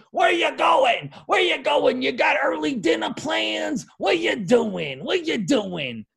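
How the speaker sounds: high, loud mock shout of an old lady; yelling old lady. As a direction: holler like a cranky ninety-year-old fan. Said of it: "That was an interesting accent".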